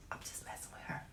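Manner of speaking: whispered aside; whispering. Real, not acted. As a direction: whisper it privately.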